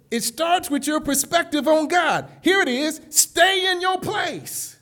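loud, rising pulpit preaching; preaching at full force. Acted. Preacher lifting the congregation, fervent full-force preaching. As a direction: build the crowd up with each line.

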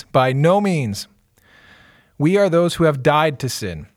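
steady, measured Bible reading; scripture reading. Acted. Pastor reading Romans chapter six to the podcast, measured reading. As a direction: read the passage clearly and evenly.

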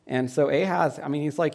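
ordinary talk

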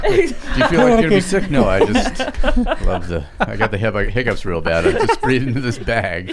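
laughing through a silly bit. Acted. Acted plane dialogue.

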